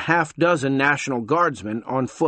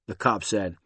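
usual speaking voice

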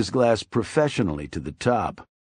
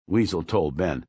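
ordinary delivery

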